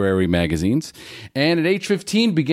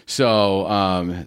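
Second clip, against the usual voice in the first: ordinary delivery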